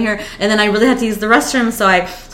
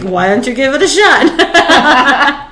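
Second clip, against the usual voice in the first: slow, louder laughing challenge; slow teasing dare; 6 semitones higher, 6 dB louder, slower than usual. Retold or imitated. The friend daring her to leave, slow teasing.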